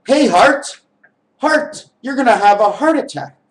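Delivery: high, loud pretend warning; mock alarmed call. Body calling out to heart.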